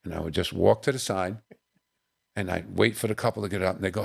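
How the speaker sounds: usual speaking voice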